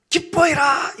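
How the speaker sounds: high-pitched calling out; drawn-out messenger's proclamation; herald's shout. Acted. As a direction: cry out the joyful news to a crowd. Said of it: "이렇게 외치는 거예요".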